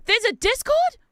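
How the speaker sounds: high, loud disbelieving question; surprised squeal. Real, not acted. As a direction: discover it with delight. Surprised to learn of Discord.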